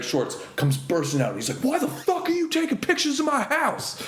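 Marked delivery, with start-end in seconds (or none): 1.6-4.0 very high, hammed-up outraged yell; mock angry bodybuilder. Comedian imitates a shirtless bodybuilder, high mock rage. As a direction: squeal the brute's fury as a joke.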